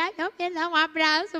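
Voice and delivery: weepy mother begging for a hug; a melting, teary mom; mãe manteiga crying; tearful soft mother. Shown as a demonstration. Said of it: "Choro da mãe manteiga".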